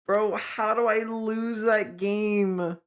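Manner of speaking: slow, stretched-out annoyance; exasperated whine. Real, not acted. Gamer who just lost a winnable game, exasperated whine. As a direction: drag the words out in disbelief. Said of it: "I'm so annoyed".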